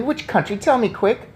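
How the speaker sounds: put-on a boy demanding the answer now; impatient urgent cry. A narrator's reading. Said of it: "I cried impatiently".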